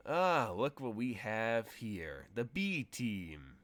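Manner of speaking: slow, drawn-out sneering greeting; mocking taunt. Leaning on his friend, sizing up rivals, slow mocking drawl. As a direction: stretch it out to belittle them. Cyborg sizes up rival crew.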